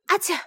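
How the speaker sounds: quick acted sneeze; little bear sneezing after the bath. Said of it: "打了个喷嚏".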